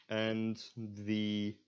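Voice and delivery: usual speaking voice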